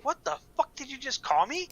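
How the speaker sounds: high, loud indignant retort. Retold or imitated. Man in the Yankees hat demands what he was just called, loud and high. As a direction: fire it back in disbelief. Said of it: "I'm like".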